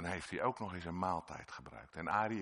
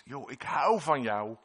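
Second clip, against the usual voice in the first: loving declaration; 5 semitones higher, 9 dB louder than usual. Acted. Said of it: "En dan zegt Jezus".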